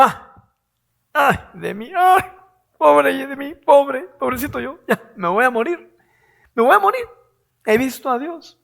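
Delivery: high, wailing cry of despair; hammed-up prophet moaning about himself; lamenting Isaiah. Preacher acts Isaiah crying out after seeing God, high wail. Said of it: "Y él dijo".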